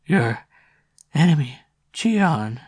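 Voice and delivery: put-on slow, croaking old woman. A narrator's reading. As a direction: croak the name darkly. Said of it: "in a croaking, strange voice".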